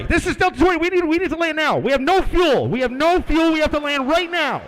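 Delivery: loud, high, urgent radio plea; panicked pilot. Acted. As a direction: shout the emergency, pitch up, desperate. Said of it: "And then we hear our guy".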